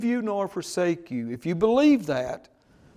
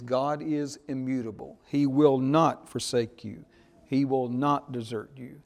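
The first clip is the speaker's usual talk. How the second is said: ordinary delivery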